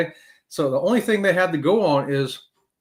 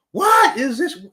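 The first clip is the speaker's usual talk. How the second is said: surprised; 9 semitones higher, 5 dB louder, slower than usual. Retold or imitated. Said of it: "I'm like".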